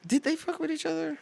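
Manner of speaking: high, startled disbelief; surprised. Real, not acted. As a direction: squeak it out in shock. Shocked reaction to sex gossip.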